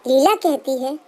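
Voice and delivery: ordinary delivery, nothing marked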